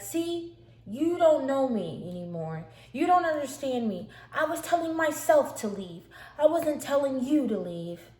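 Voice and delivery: loud, high imitated rant; agitated insistence. Sister explains she was telling herself, agitated and loud. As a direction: insist desperately that she misunderstood.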